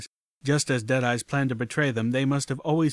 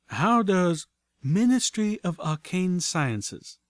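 slow, higher refined mare voice; casual explanation; 7 semitones higher, much slower than usual. A narrator's reading.

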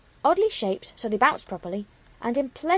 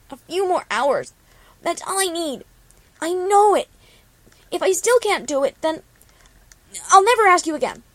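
desperate pony pleading louder with each line; desperate plea; 8 semitones higher, 7 dB louder than usual. A narrator's reading. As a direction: beg harder and harder until it breaks out. Desperate pleading to keep going.

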